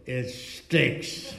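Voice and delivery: deadpan blunt verdict, imitated; very frank. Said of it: "very frank".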